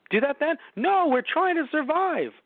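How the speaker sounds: high, slow goofy dinosaur; high silly dinosaur voice. Pretend. Acted dinosaur herd dialogue.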